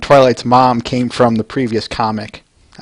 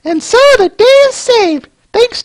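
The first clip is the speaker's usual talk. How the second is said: falsetto narrator. Acted. Narrator wrapping up the comic, high storybook voice. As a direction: announce the happy ending in falsetto.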